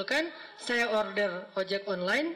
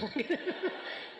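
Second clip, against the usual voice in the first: breathy laugh; 7 semitones higher, 5 dB quieter than usual. Real, not acted. Laughing at his own story.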